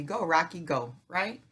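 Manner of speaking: usual speaking voice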